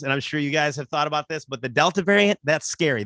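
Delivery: ordinary talk, nothing marked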